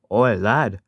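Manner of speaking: high, loud attempt at Scottish accent; Donald and Douglas. Shown as a demonstration. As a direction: call out with a put-on Scottish accent. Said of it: "here's my Donald and Douglas voice".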